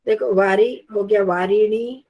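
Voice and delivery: ordinary talk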